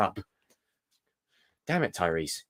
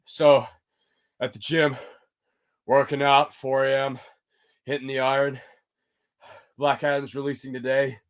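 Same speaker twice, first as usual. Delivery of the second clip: Rock impression; 3 semitones higher than usual. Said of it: "Do the Rock five A M in the morning impression".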